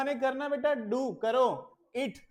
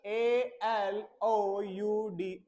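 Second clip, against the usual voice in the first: spelling aloud; much slower than usual. Shown as a demonstration. Teacher spelling a word for pupils, slow and clear. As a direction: spell "R E A D" slowly letter by letter. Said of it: "allowed".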